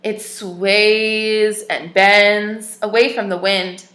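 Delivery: clear, even storybook reading voice; story narration. Acted. Teacher reads a picture book to children, storybook narration. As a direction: read the tale aloud to little listeners.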